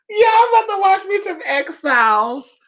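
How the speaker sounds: high, thrilled cry; excited squeal. Real, not acted. Vlogger telling viewers her night plan, high excited squeal. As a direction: announce it like a treat.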